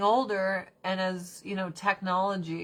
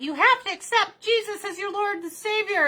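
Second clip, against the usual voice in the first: much higher, put-on preachy grandma voice; imitating grandma; 12 semitones higher, 4 dB louder than usual. Grandson mocks grandma pushing Jesus, high preachy voice. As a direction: mimic a churchy grandma lecturing. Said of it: "my grandma being like".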